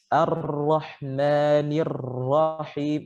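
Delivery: Ar-Rahmanir Rahim with ro rolled far too long, slow; over-trilled ro. Shown as a demonstration. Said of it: "pengulangannya terlalu banyak dan ini keliru". (Ar-Rahmanir Rahim dengan ro bergetar terlalu banyak, keliru)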